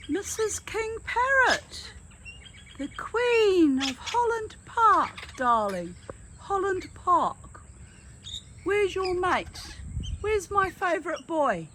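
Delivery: high, slow sing-song cooing; campy drag queen. Acted. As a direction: gush over the bird like a diva.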